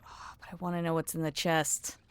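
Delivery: ordinary talk, nothing marked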